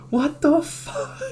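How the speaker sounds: high, slow, drawn-out what the; stunned disbelief. Retold or imitated. Seeing his soldiers at the club, stunned. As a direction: caught off guard, voice leaping up. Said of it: "And I'm like".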